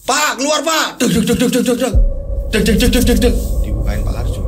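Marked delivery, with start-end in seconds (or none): 0.1-1.0 high-pitched summons at a door; urgent calling out. Acted. Couple banging on the shaman's door, urgent calling. 1.0-3.3 rapid, high door-banging noise; imitated door pounding. Narrator imitating frantic knocks on a door, rapid pounding. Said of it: "mereka ini gedor-gedor rumahnya".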